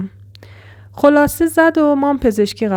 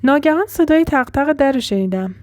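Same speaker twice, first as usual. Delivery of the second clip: usual speaking voice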